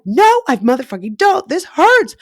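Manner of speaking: loud high retold cry of refusal; retold angry outburst. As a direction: explode in pain and anger. Retold outburst after doubting herself.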